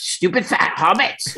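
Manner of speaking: a light, slow Gollum sneer on "Stupid fat hobbits"; just a hint of Gollum, drawn out; lightly voiced Gollum; imitating Gollum. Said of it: "when Gollum says".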